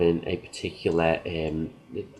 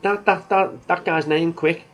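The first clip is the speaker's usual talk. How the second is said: rapid-fire, much higher, pressing for the name; urgent quick demand; 9 semitones higher, 4 dB louder than usual. Retold or imitated. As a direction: fire it at him to catch him off guard.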